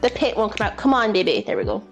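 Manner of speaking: ordinary talk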